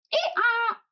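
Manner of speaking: very high hee-haw bray; donkey bray. A narrator's reading. Donkey braying at Paddington's sandwiches, high bray. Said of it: "brayed the donkey".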